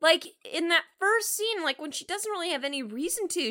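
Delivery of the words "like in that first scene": normal talking voice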